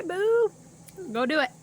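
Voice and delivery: high, loud playful squeal; excited. Real, not acted. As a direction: squeal the word with glee. Urging viewers to try it.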